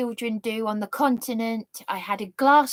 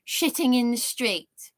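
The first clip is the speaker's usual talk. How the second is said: ordinary talk, nothing marked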